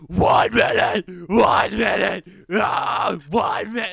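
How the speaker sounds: loud harsh screamed hook; imitated screamo vocal. Host imitating what you hear in the track, raw screaming. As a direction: scream it like a metal chorus. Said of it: "And then you hear".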